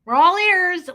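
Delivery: high, loud Tennessee mom; Tennessee accent. Retold or imitated. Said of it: "And my mom does it too".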